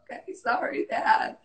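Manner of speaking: breathy, crying "sorry" to dad; tearful apology. Retold or imitated. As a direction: whimper the apology through tears. Tearful kid answers scolding dad.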